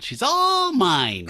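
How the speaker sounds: gloating Superman. Retold or imitated. Superman brags about his cat girl, slow smug gloat. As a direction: draw it out, smugly pleased. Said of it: "He's like".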